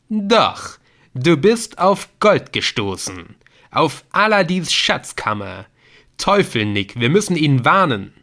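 astonished and urgent; excited realisation. A narrator's reading. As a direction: blurt out the big find, emphatically. Viktor realises what Nick found.

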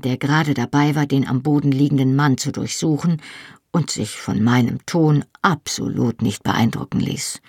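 usual speaking voice

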